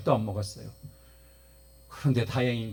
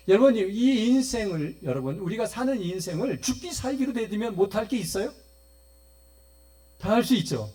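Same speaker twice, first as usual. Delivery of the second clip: fervent preaching; 8 semitones higher, 4 dB louder than usual. Real, not acted. Preacher exhorting congregation.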